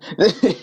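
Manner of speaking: a quick high laugh at his own bit; short laugh. Real, not acted. Laughs after the acted apology.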